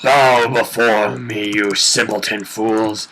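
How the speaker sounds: slow, loud, booming tyrant voice; megalomaniac rant. Pretend. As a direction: command the fools to kneel. Villain voice demanding that people bow.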